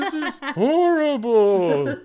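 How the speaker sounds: high, slow, scandalized wail; outraged society lady. Pretend. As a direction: clutch your pearls and wail. Mock outraged 1920s audience.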